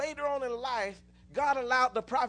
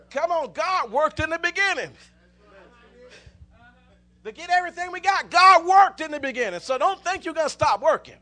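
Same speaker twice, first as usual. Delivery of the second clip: much louder, rising preacher's call; preaching at full force; 4 semitones higher, 8 dB louder than usual. Real, not acted. Preacher driving the congregation, loud and building. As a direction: lift the whole church with it.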